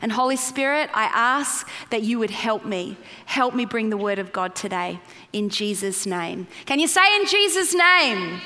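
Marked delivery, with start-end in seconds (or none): none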